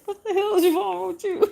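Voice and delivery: high, loud quote broken by laughter and tears; laughing and crying. Retold or imitated. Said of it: "She said".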